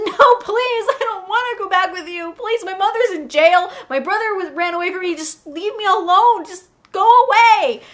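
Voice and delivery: high-pitched, loud mock begging; acted desperate pleading. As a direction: beg captors to leave you alone. Pleading with alien captors.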